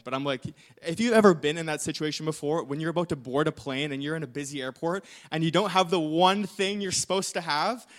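normal talking voice

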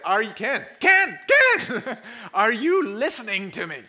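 imitating his wife's insistent nagging; wife calling his name. Pastor imitating his wife demanding he listen, rising calls. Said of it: "She has said".

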